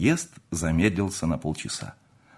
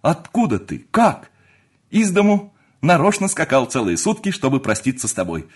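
high, loud, astonished exchange; elated, high-pitched eagerness; excited reunion; 8 semitones higher, 6 dB louder than usual. Acted. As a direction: blurt it out in amazed joy. Friends reunite in amazed joy.

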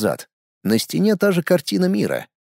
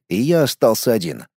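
usual speaking voice

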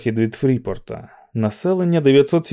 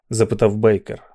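usual speaking voice

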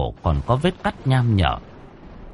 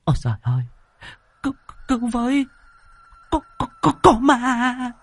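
panicked pleading; faster than usual. A narrator's reading. Woman begging the youth to save her, panicked. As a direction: beg in terror, voice climbing to a squeal. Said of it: "rồi hốt hoảng van xin".

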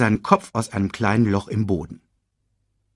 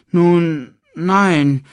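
high cub voice admitting it; reluctant admission; 10 semitones higher, 7 dB louder than usual. A narrator's reading. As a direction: own up to your uncle. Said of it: "gab Simba zu".

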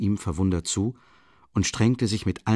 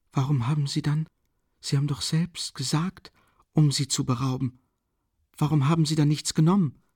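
Sonja's voice, higher and quick; a young woman asking quickly, higher voice; quick anxious question; 7 semitones higher than usual. Acted. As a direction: press him fast, clutching at a straw. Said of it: "fragte sie ihn schnell".

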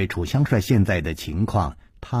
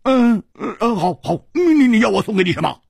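higher, fast flustered pleading; anxious stammer; 9 semitones higher, 3 dB louder than usual. Acted. Hu Tiehua desperately asking what the boy wants. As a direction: rush the words out desperately.

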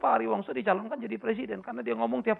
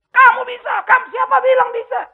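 shouted, much higher and louder; mock motivator cheer; 12 semitones higher, 11 dB louder than usual. Pastor mocks motivators telling everyone they can, shouting. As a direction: pump it up like a seminar speaker.

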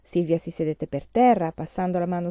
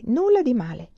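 ordinary delivery, nothing marked

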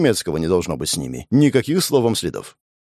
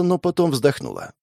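ordinary delivery, nothing marked

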